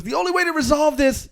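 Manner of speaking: mock-dramatic challenge, high start falling to a slow reveal; wrestler girl's challenge. Wrestler announcing the bull contest to the crowd, mock-dramatic imitation. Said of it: "And then she goes".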